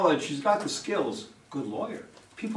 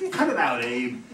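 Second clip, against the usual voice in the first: laughing; 3 semitones lower, 4 dB louder than usual. Acted. Laughing at the couple's mushiness.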